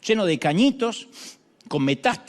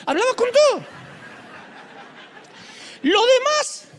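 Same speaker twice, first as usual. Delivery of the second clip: laughing outburst; 4 dB louder than usual. Real, not acted. Laughing after the Chile joke.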